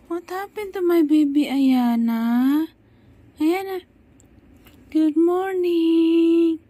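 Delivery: high, loud sing-song cooing. Real, not acted. Mother coos to crying baby. (malambing at malakas na pagkausap sa sanggol, mataas ang boses)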